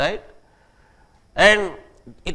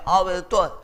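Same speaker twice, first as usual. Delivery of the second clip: imitated street English; 2 semitones higher, much faster than usual. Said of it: "They used to say".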